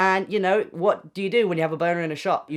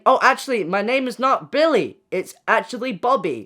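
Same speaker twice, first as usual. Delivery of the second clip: mock correction; 6 semitones higher, 4 dB louder than usual.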